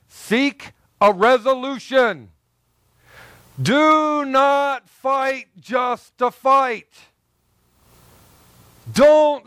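high, loud, slow cry of warning; preaching shout. Real, not acted. Preacher shouts to the congregation not to fight for fighting's sake, loud and slow. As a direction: hammer each word from the pulpit. Preacher driving home point.

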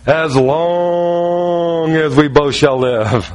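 very slow, hammed-up wedding vow; drawn-out vow. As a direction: drag the vow out as if it were endless. Jokingly recites his own wedding vows.

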